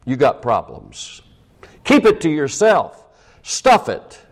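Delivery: high, loud mock advice; mocking stiff upper lip. Preacher mocking the repressed British attitude. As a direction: sneer out the old motto.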